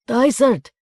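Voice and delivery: loud high call. Acted. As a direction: shout the name across the yard. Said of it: "called the man behind him".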